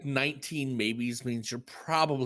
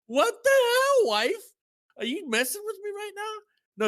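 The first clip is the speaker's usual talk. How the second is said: high, slow, louder disbelief; incredulous outburst; 4 dB louder than usual. Retold or imitated. Telling friends how he reacted to his wife, high incredulous retold outburst. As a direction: act out total bafflement at her. Retelling his wife's baffling claim.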